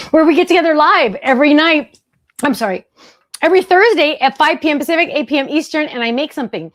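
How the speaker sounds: breathless from running back; a bit winded; out of breath. Real, not acted. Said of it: "out of breath".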